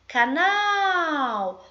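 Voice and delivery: high, loud call with a long-drawn last syllable. Shown as a demonstration. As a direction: call it as if to someone across the street. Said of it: "faço de conta que ela tá lá do outro lado da rua e chamo".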